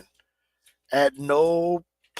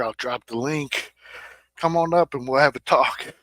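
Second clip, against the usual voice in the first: usual speaking voice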